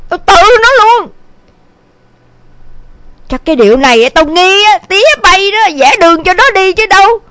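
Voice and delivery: understated, indignant threats; fuming mother. A narrator's reading. Mother disowns son and blames his father, moderately angry. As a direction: vow to disown a son, grumbling angrily. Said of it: "Thấy mẹ bốc hỏa giận dữ".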